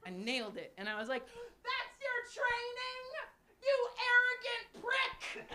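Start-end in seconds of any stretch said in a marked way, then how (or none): 1.3-5.5 high, slow, rising yell; yelling at the millionaire on the trail; triumphant yell. Retold or imitated. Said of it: "I just gotta yell".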